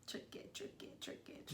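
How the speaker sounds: playful repeated whisper; whispered echo. Pretend. Partner echoes "it's tricky" under the breath, whispering. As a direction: whisper it as a sneaky little aside.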